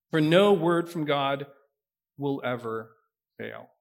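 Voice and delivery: measured, expressive Bible reading to the congregation; scripture reading. Acted. Pastor reading the annunciation to the church, solemn and expressive. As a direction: read the passage aloud with weight, voicing the angel fuller. Pastor reads Luke passage aloud.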